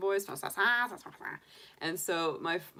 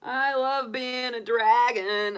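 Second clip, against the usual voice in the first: higher, louder, slow and gleeful little dragon; funny dragon voice; 6 semitones higher, 9 dB louder, slower than usual. Acted. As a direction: boom it out proudly as your cartoon dragon. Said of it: "Dragon commentary".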